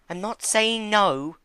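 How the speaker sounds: very high, slow, timid pony voice; gentle Fluttershy. Acted. Fluttershy holding back Discord, high, slow and timid. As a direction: ease into it, timid.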